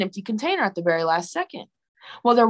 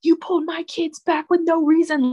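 mom's angry words; 8 semitones higher than usual. Retold or imitated. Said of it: "She was like".

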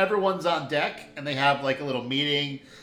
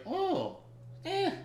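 Wave's voice; 12 semitones higher, 8 dB quieter than usual. Pretend. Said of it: "Wave is like".

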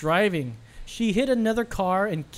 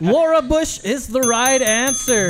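loud, high, drawn-out game show reveal; announcer's verdict; 6 semitones higher, 5 dB louder, slower than usual. Pretend. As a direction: proclaim the winner slowly and big. Host announces the judge's verdict.

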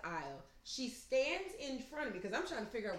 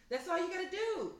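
ordinary delivery

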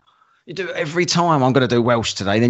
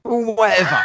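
higher, laughing brush-off; laughing dismissal. Real, not acted. Laughing off the co-host's denial, amused dismissal.

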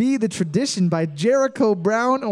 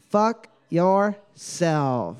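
drawn-out option; 4 semitones lower, much slower than usual. Shown as a demonstration. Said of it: "slow reread of option".

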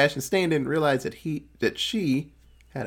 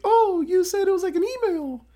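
much higher, innocent mock assistant. Assistant corrects the doctor, high innocent voice. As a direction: chirp it up high like a clueless helper. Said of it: "assistant's like".

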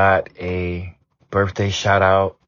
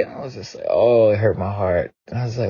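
ordinary delivery

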